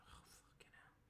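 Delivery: barely voiced oh, fucking hell; whispered exasperation. Real, not acted. Groaning at a reader's pun, whispered exasperation. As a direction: whisper it out in weary despair.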